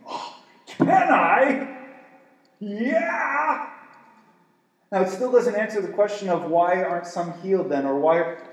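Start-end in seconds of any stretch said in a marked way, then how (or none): 0.1-3.7 excited. Pretend. God voiced excited.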